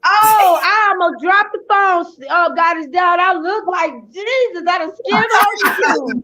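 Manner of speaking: flustered. Real, not acted. Guest panics over dropping phone.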